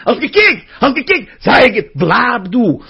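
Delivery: loud, high gotcha cries; taunting Yetzer Hara. Acted. The Yetzer Hara to someone who glanced, taunting. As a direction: pounce on the catch, high and loud. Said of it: "und er sagt ihm".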